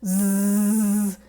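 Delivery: long held buzz like bees; buzzing zzz. Shown as a demonstration. Teacher shows the buzz with hands over ears. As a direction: hum a steady zzz. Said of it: "Or you make the sound".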